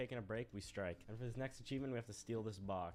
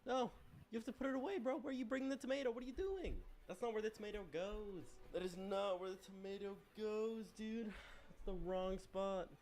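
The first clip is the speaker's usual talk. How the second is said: higher, fussy scolding voice; mock scolding; 11 semitones higher than usual. Goose lecturing the gardener about the tomato, higher mock scolding. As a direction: tut at him like a prissy know-it-all.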